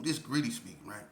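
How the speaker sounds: ordinary talk, nothing marked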